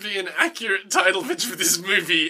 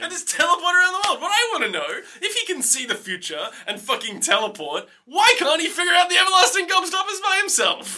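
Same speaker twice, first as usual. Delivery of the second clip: high-pitched comic tirade; exasperated disbelief; 11 semitones higher than usual. Real, not acted. Host questions Slugworth's powers to co-host, exasperated rant. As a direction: climb to an outraged final question. Rant continues about plot hole.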